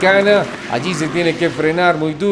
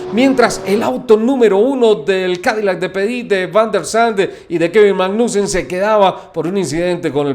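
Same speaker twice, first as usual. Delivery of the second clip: motorsport commentator; 3 semitones higher than usual. Acted. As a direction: call the checkered flag with rising excitement. Finish-line race call.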